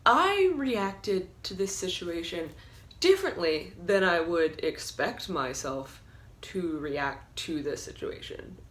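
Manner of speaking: normal talking voice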